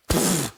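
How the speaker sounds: short lip puff like a tire blowing; imitated tire pop. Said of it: "I just hear this, like".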